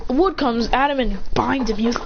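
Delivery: ordinary delivery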